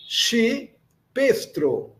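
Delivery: slow dictation of Chipestro, split in two; syllable by syllable. Shown as a demonstration. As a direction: break Chipestro after CHI for learners. Teacher splits new word into syllables.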